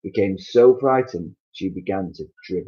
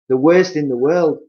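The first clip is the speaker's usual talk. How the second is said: Mister Twit voice; 8 semitones higher, 9 dB louder than usual. Acted. Husband scaring.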